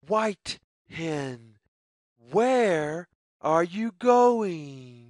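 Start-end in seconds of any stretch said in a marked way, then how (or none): none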